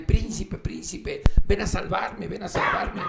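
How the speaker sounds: high, loud princess crying for help; princess calling out. Acted. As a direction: call to the prince from far away. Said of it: "la princesa empieza a gritarle". (princesa gritando pidiendo auxilio)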